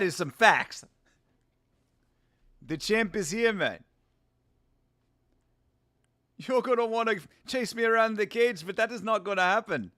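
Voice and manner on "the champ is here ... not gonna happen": put-on South African fighter voice; cocky champ with a South African accent. Streamer as champ refusing to be chased, South African accent. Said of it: "I like that South African accent".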